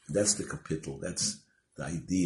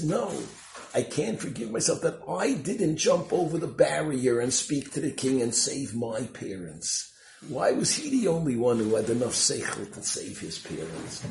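loud, raised retelling of a tearful outburst; anguished self-reproach; 8 semitones higher, 7 dB louder than usual. Retold or imitated. Survivor reproaches himself to the correspondent, anguished and loud. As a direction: blame yourself, high and insistent, mock-tearful. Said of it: "suddenly starts crying".

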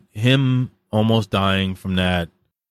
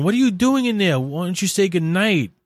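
high-pitched mom voice; imitated mother; 11 semitones higher than usual. Mother at bedroom door wanting goodnight, imitated high voice. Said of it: "and she's going".

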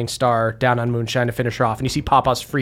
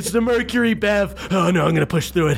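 higher, straining to push through; straining old warrior; 5 semitones higher, faster than usual. Acted. Balnor straining through heart attack.

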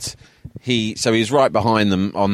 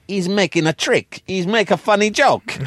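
much higher, amused Italian voice; high, delighted mock Italian; amused retelling; 12 semitones higher than usual.

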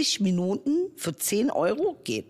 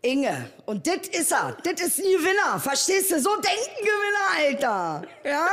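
boastful winner; 9 semitones higher, 4 dB louder than usual. Acted. Boasting winner pose, Berlin dialect.

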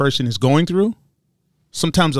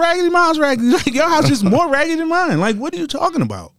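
much higher, hammed-up ranting disbelief; mock exasperation; 12 semitones higher than usual. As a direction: poke fun in a high squeaky rant. Continues mocking high voice.

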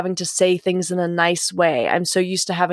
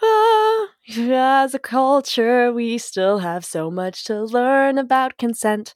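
mock lofty; 5 semitones higher, slower than usual. Sums up consent culture in a mock-lofty high voice. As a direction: announce it like a grand truth.

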